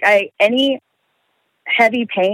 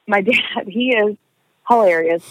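ordinary talk

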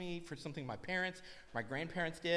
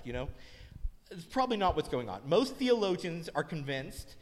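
normal talking voice

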